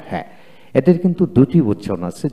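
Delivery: usual speaking voice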